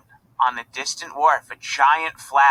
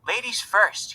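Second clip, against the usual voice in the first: Irish brogue; 8 semitones higher than usual. A narrator's reading. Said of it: "he said in an Irish brogue".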